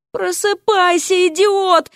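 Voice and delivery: high shriek, drawn out on Просыпайся. A narrator's reading. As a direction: shriek at a sleeping idiot to wake him. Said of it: "завизжала она".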